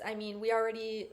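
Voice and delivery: ordinary delivery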